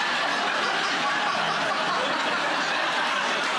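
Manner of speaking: squealing laugh, high and breathy; high shrieking laugh. Real, not acted. Host cracking up at the joke, shrieking with laughter. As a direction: burst into a shrieking cackle.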